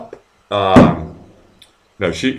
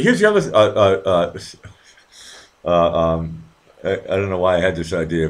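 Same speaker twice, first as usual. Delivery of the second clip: ordinary talk, nothing marked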